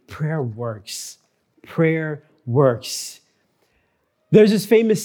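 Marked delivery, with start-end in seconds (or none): 0.1-1.2 hushed emphasis. Shown as a demonstration. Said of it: "listen very clearly".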